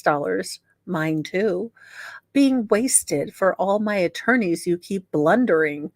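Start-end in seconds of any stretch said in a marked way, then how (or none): none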